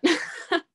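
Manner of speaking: a short high giggle; light laugh. Real, not acted. Singer laughs at her grandma-like hobby, giggling. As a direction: giggle at herself, a little embarrassed.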